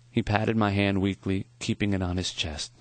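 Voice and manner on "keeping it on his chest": ordinary delivery, nothing marked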